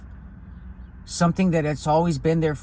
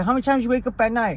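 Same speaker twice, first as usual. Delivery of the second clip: higher, fast grandfather voice; quick grandpa question; 7 semitones higher, faster than usual. Retold or imitated. Grandfather quizzing his grandson about his nights, higher and fast. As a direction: rattle it off like grandpa probing.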